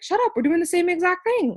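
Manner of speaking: high, loud delighted disbelief; shocked squeal. Retold or imitated. Retelling meeting the bracelet girl, high shocked squeal. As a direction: squeal it like she can't believe it. Retold astonished reaction.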